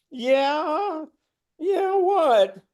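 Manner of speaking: wide-eyed what; high surprised reply. Retold or imitated. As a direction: squeak out the answer. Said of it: "I go".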